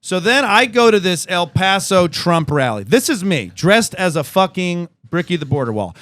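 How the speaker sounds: normal talking voice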